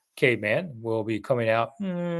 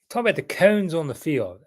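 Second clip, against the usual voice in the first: imitated Southern accent, higher pitched, "corners" as "cones"; Fred Smoot; 4 semitones higher than usual. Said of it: "Fred Smoot has a big Southern accent".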